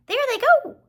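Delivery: high squeaky rat voice. Acted. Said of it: "he squeaked".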